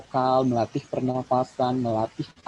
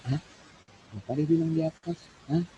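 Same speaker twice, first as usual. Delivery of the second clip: penonton bingung; 5 semitones higher, faster than usual. Retold or imitated. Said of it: "penonton itu dengar".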